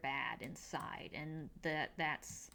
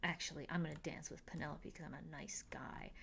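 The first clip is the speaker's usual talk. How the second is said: whisper; 2 semitones lower, 8 dB quieter than usual. Retold or imitated. Colin voiced in retold scene.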